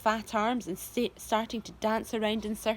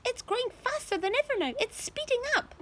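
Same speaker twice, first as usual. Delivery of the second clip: very high thrilled calling; excited aunt. A narrator's reading. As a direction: gasp it out excitedly. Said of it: "Aunt Spiker shouted".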